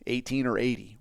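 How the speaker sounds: ordinary talk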